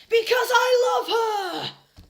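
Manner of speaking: hammed-up slow shriek, high and loud. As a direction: wail the confession melodramatically. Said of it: "Draco shrieked".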